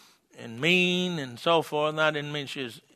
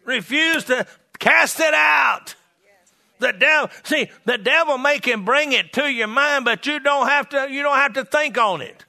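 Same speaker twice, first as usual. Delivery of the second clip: punchy, raised sermon delivery; full-force preaching; 8 semitones higher, 4 dB louder than usual. Real, not acted. Preacher urging the church to refuse the thought, forceful preaching. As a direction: preach it hard to the congregation.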